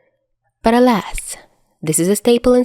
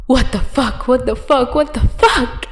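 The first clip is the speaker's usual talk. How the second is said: rising, building disbelief, higher each time; panicked disbelief; 5 semitones higher than usual. Acted. Dazai reeling after the kiss, rising frantic disbelief.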